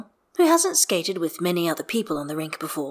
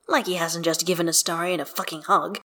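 ordinary delivery, nothing marked

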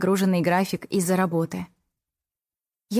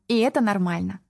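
ordinary delivery

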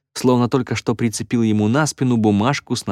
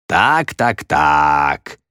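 drawn out; 4 semitones lower, much slower than usual. A narrator's reading. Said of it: "протянул Эль Магнифико".

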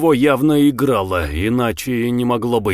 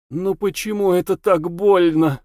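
anguished question; 6 semitones higher than usual. Acted. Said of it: "вопросило оно".

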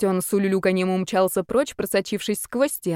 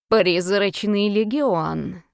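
slow eerie hiss; much slower than usual. Acted. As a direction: hiss it out slowly. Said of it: "прошипел он". (медленное шипение)

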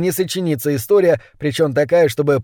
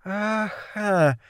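soft, high, drawn-out satisfaction; pleased drawl; 7 semitones higher, 7 dB quieter than usual. A narrator's reading. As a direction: stretch it out quietly, very pleased with yourself. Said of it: "протянула злокознее с очень довольным видом". (довольно тянет, тихо и высоко)

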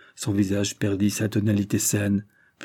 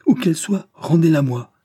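much higher and louder, agitated exclamation; excited demand; 8 semitones higher, 6 dB louder than usual. Acted. Drishna to Carados, demanding his ruler: loud excited cry. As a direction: demand it back, worked up and rising. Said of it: "s'exclama Drishna, de plus en plus excité".

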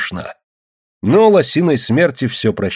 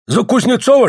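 high-pitched insistent call; shouted question; 13 semitones higher, 4 dB louder than usual. A narrator's reading. Lomov presses Spirka on his choice, shouted question. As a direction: raise it high to press him. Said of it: "крикнул Ломов".

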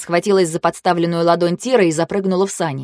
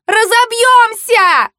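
very high, drawn-out scream; shrieking; 5 dB louder, much slower than usual. A narrator's reading. Girl sure the sled will crash, shrieking. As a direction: stretch it into a loud shriek. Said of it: "визжала Фэю".

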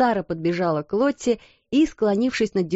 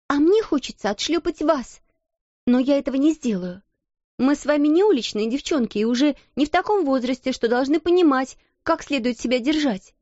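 irritated rebuke; 7 semitones higher than usual. A narrator's reading. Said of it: "не в силах сдержать раздражение".

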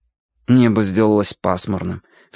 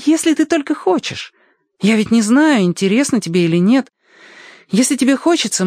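put-on high, animated boyish voice; eager boy; 13 semitones higher than usual. Boy invites Alyoshka to meet the singing wind, eager high voice. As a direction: coax a new friend, pitched well up.

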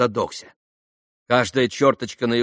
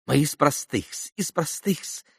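higher-pitched discreet aside; prompting captain; 5 semitones higher, slower than usual. A narrator's reading. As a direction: slip in the correction in a high undertone. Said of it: "подсказал ещё раз капитан".

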